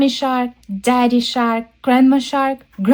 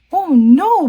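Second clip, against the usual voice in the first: put-on high, loud childlike dismay; Baby Shark; 7 semitones higher, 6 dB louder than usual. Baby Shark sees the spell fail, dismayed child voice. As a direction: wail it lightly like an upset little one. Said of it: "Baby Shark says".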